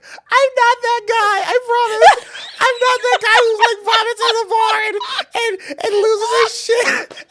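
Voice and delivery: high, loud sobbing protest; crying plea. Retold or imitated. Drunk man pleading with the bar lady, crying high and loud. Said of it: "I'm crying".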